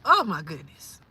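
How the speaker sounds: usual speaking voice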